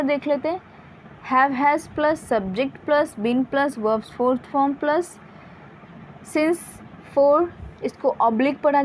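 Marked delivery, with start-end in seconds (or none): 1.3-7.5 each part of the formula said very slowly; slow dictation. Shown as a demonstration. Teacher to students, dictating the structure very slowly. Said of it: "dictating structure formula".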